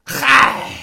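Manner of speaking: exasperated sigh. Acted. Master gives up in exasperation.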